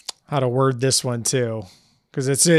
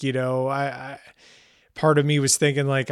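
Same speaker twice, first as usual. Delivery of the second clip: ordinary delivery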